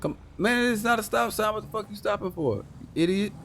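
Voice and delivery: high, exasperated shout at a bad driver; annoyed yell. Real, not acted. Cop yelling at a driver who stops for nothing, exasperated. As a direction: yell at the idiot ahead.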